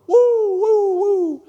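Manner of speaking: high, loud imitation of a police siren. Preacher imitating a state trooper's siren in the joke, loud siren wail. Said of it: "here comes a state trooper".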